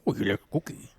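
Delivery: low, soft monster impression; Cookie Monster. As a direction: try a low puppet-monster voice. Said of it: "Cookie Monster's quite low-pitched".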